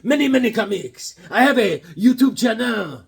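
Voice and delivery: hint of French accent, bragging. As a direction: brag as the French guy. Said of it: "He's like".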